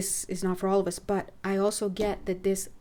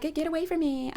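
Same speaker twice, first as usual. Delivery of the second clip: mock rejection; 8 semitones higher than usual. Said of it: "it wasn't anything so dramatic".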